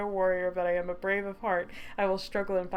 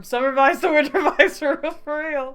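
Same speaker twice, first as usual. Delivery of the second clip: laughing; 7 semitones higher, 13 dB louder than usual. Real, not acted. Laughing reaction to scene change.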